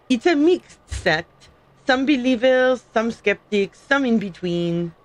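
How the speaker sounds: hammed-up French accent, reading in character; amateur mock-French accent; fake French accent. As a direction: put on a bad French accent. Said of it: "That was a terrible fake French accent".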